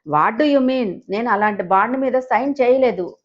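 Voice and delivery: usual speaking voice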